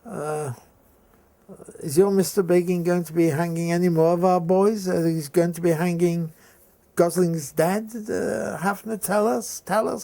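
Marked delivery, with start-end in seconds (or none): 1.8-10.0 higher, louder snide taunting of a pupil; snide teacher. Retold or imitated. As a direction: needle the boy with mock sweetness, pitched up. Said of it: "very snidely".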